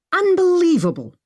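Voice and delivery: high, loud exclamation; exclaiming. A narrator's reading. Maggie exclaiming at her friends. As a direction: burst out in disbelief. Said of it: "Maggie exclaimed".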